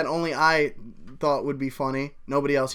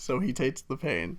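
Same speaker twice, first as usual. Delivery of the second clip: softer, tentative restart after cracking up; subdued retake; 2 semitones lower, 7 dB quieter than usual. Real, not acted. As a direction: pick the line back up quietly, ending on a rise.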